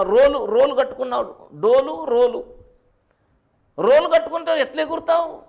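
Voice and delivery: loud, high exhortation; full-force preaching. Real, not acted. Preacher challenges flock about climbing up weighed down, full-force preaching. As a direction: challenge the listeners at high pitch and volume.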